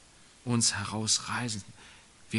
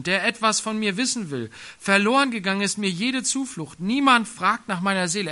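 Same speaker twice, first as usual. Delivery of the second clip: louder, solemn psalm read to the congregation; scripture reading; 4 semitones higher, 6 dB louder than usual. Acted. Preacher reads Psalm 142 to the church, loud and solemn. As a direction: proclaim the lament with weight.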